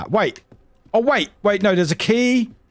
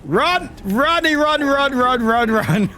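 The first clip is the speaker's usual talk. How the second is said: urgent high panicked run calls; being chased; 6 semitones higher, slower than usual. Real, not acted. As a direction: flee in a panic, urging.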